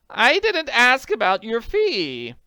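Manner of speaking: stammering. Acted. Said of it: "she stammered".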